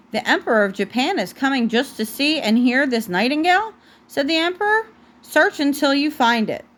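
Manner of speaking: gentle storybook read-aloud; picture-book narration for a toddler; reading to a child. Acted.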